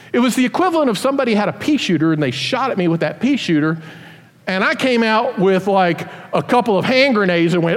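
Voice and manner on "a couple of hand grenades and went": ordinary talk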